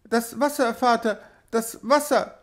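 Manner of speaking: put-on small child calling out in fright, pitched high; frightened child's call. A narrator's reading. Child to father at the sea, high frightened call. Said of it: "rief sie".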